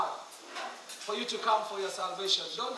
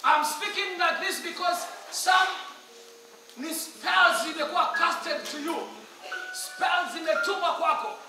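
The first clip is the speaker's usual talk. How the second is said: higher-pitched, emphatic pulpit voice; forceful preaching; 7 semitones higher, 5 dB louder than usual. Acted. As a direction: press the warning home like a revival preacher. Preaches forcefully about spiritual bondage.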